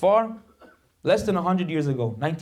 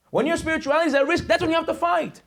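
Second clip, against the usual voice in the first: high, ringing rallying cry held to the end; impassioned preaching; 10 semitones higher than usual. Real, not acted. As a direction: drive the whole line home, pitched high. Preacher repeats the line for force.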